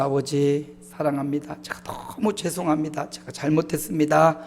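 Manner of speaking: a son texting his father an apology; tearful plea. Real, not acted. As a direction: quietly beg forgiveness, close to tears. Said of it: "용서를 빌었습니다".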